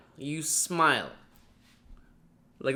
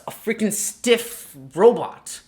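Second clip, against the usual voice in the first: normal talking voice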